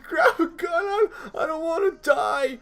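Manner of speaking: very high, mock-sobbing wail; crying in panic. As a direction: imitate a terrified kid crying for help. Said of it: "I was crying".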